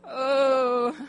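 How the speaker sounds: slow, tearful praise breaking into sobs; tears of gratitude, real crying; crying with joy. Real, not acted. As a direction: weep through the words. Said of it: "when I cry".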